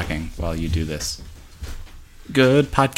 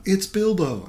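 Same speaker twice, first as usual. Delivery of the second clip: put-on excited teen boy; 11 semitones higher, slower than usual. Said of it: "one of them went".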